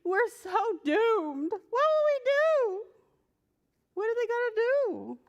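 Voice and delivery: high, hammed-up panic; panicky. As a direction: whimper that all is lost. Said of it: "the men said".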